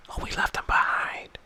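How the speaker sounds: whisper. Retold or imitated. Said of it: "he just".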